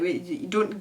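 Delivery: ordinary talk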